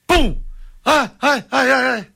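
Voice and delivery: immediate thud, then high mock ouches; queda de pouca altura. Shown as a demonstration. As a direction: imitate a short drop, impact first, whimpering after. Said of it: "Se cair do primeiro andar é". (baque seguido de gemidos fingidos)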